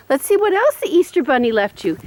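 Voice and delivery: ordinary talk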